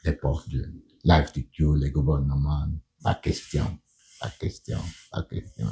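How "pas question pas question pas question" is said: hushed, barely voiced refusal; retold refusal. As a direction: repeat it very softly, like doors closing one by one. Acting out officials refusing his requests. (refus répété à voix très basse)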